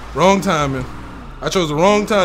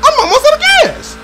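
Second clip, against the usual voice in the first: panicked; 4 dB louder, faster than usual. Real, not acted. Running low in chase.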